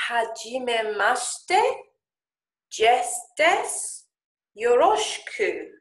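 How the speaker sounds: very slow, hesitant beginner Japanese; "hajime mashite" dragged out, rising on "desu"; halting Japanese greeting. Retold or imitated. Student nervously trying Japanese on a Japanese girl, halting.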